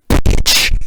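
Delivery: bitch punched out loud and sharp; heavy stress on the word bitch; emphasis on bitch. Real, not acted. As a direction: hit bitch hard and loud, almost spat. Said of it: "I gotta put the emphasis on bitch".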